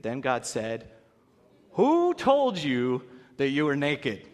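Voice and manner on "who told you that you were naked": very slow, searching voice of God; slow divine question. Acted. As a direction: ask it slowly, like God probing. God questions Adam, retold.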